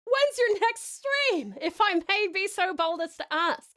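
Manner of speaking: lightly put-on British accent. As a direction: ask it like a prim British lady. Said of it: "British accent redeemed".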